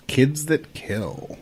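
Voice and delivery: slow, dramatic, each word drawn out; ominous slow announcement. Acted. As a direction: drag it out like a horror trailer. Dramatic reveal of episode topic.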